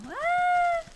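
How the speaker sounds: high sudden yelp; startled yelp. Real, not acted. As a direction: yelp in alarm. Sudden danger in game.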